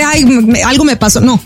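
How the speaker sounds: usual speaking voice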